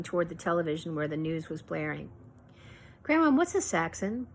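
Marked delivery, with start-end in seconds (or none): 3.0-4.3 put-on child's question. A narrator's reading. Child asks grandmother.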